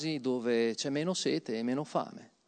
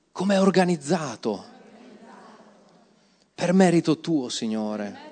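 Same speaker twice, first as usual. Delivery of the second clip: preaching praise; 3 semitones higher, 6 dB louder than usual. Acted. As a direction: preach it with rising fervour. Ecstatic praise of the church. (lode predicata con fervore crescente)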